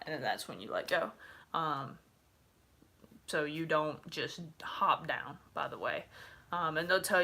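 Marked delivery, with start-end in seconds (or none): none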